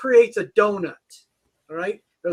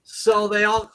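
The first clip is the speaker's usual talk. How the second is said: normal talking voice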